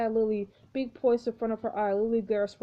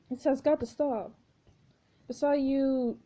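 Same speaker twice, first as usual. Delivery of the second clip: normal talking voice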